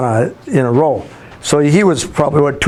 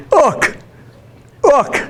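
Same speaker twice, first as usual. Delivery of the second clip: put-on much higher little-boy call, one short word; imitated excited toddler; 11 semitones higher than usual. Toddler son showing dad his stacked cans, excited. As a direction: mimic a small child proudly demanding attention. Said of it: "he was so excited about".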